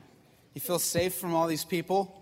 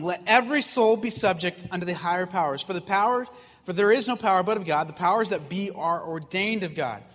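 measured, louder Bible reading voice; scripture reading; 5 dB louder than usual. Acted. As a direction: read the verse out like holy writ to the congregation. Reads scripture verses aloud.

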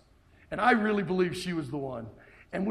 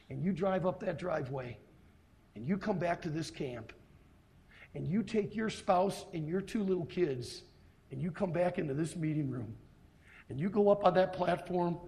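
ordinary delivery

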